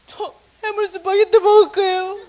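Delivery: high, loud tearful whine; mock crying child. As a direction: wail the demand through tears. Son sobbing insistence.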